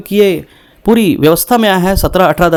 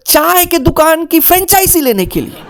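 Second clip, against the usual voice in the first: whiny investor; 9 semitones higher than usual. Retold or imitated. Sobbing investor's answer.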